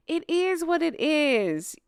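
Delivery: sing-song mocking take, slowed; mock the phrase, drawn out. Mocking the couple's saying.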